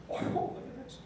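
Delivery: fighting back tears, words barely getting out; tearful, breaking whisper; choked up. Real, not acted. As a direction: voice catching, nearly voiceless with real emotion. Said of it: "I get a little choked up".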